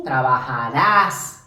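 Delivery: slow trabajarás, stressed on rás; final stress. Shown as a demonstration. Teacher showing the tú ending, trabajarás stressed on rás. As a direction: land on the last syllable of trabajarás. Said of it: "con acento al final en ras".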